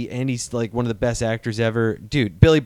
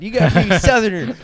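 usual speaking voice

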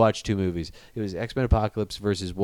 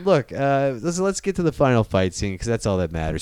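normal talking voice

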